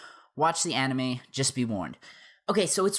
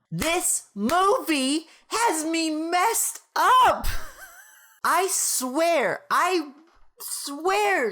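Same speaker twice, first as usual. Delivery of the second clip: high, slow, drawn-out exclamation; exasperated yell; 8 semitones higher, much slower than usual. Real, not acted. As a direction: stretch it out, fed up. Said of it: "I'm just gonna yell at the camera".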